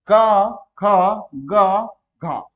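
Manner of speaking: का, खा, गा, घा slow with long vowels; letter demonstration. Shown as a demonstration. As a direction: stretch each syllable out firmly. Teacher demonstrates the letter sounds again.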